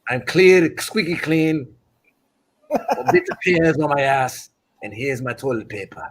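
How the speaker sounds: put-on Irish traveller accent; cocky, comic boxer in a mock Irish accent; Fury. Friend plays the cleared boxer, mock Irish accent. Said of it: "how was my accent".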